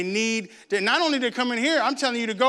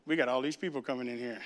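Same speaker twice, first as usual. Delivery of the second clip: quiet; 9 semitones lower, 10 dB quieter, faster than usual. Shown as a demonstration. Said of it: "It's just how you say it".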